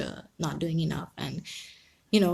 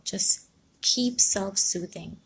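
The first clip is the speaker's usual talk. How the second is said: usual speaking voice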